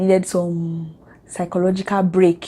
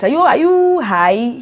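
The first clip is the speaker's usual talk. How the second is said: higher, louder disbelieving question; mock incredulous retort; 5 semitones higher, 5 dB louder than usual. Answering those who say God came late, mock incredulous. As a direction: challenge them with exaggerated disbelief.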